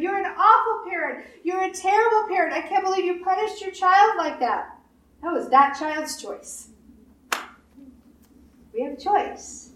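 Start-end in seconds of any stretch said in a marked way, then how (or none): 0.0-4.8 higher, lightly outraged blaming voice; mock accuser. Preacher mimicking someone blaming a parent, mock outraged. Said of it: "you don't say to that parent".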